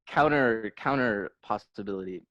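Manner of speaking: ordinary talk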